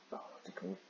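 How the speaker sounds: a breathy whisper while searching memory; whispered to self. Real, not acted. Reader whispers to himself, trying to recall the show's name. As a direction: whisper it as you try to remember.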